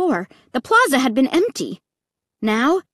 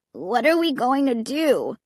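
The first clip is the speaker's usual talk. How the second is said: put-on whining kid; 3 semitones higher than usual. A narrator's reading.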